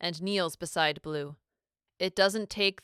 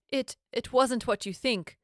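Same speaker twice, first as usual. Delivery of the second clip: stammering; 5 semitones higher than usual. A narrator's reading. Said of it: "Blue stammers".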